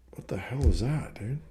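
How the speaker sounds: hushed, breathy aside under the breath; startled whisper. Real, not acted. Whispering to someone nearby, startled by something. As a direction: drop to a whisper as if something odd just happened.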